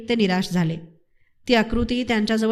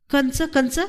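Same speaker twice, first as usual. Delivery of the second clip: eager question, pitched a little higher; 4 semitones higher, slower than usual. A narrator's reading. Hungry villagers all asking Tainu at once, eager questioning. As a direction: ask it keenly, voice lifted. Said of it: "सर्वांनीच तो प्रश्न एकदम विचारला".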